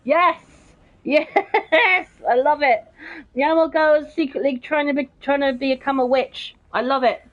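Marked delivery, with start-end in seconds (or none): 0.0-3.9 laughing. Real, not acted. Laughing at witch joke.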